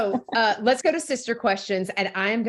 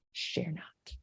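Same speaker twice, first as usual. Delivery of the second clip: breathy conspiratorial whisper, much softer; playful whisper; 4 semitones lower, 15 dB quieter than usual. Pretend. Host teasing the guest to confess her guilty pleasure, whispering. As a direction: whisper it like a shared secret.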